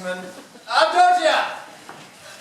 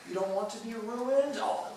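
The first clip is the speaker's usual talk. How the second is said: ordinary talk, nothing marked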